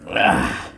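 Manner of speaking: strained grunt tightening bolt; effortful grunt. Real, not acted. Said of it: "Sorry for the grunting there".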